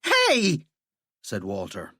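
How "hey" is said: indignant yell. Acted. Walter gets cuffed.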